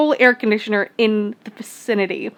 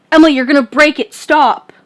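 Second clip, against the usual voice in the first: quick, louder mock snap; retold snappy telling-off, not a yell; 3 semitones higher, 6 dB louder than usual. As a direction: snap it out like a stressed friend. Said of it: "snappy way".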